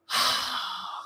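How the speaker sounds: long exhaled sigh; appreciative sigh. Real, not acted. Reader savouring a beloved passage, long appreciative sigh. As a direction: let out a long, contented breath.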